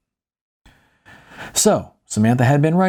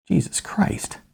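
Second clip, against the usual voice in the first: a stunned, hushed aside; exasperated whisper; 9 semitones higher, 5 dB quieter, slower than usual. Acted. Narrator whispering in disbelief at the torch-bearing protesters. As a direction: breathe it out in disbelief, unvoiced.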